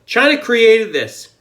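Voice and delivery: high angry yell, slow; angry shout. Real, not acted. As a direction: shout it out, furious. Angry rant at the public.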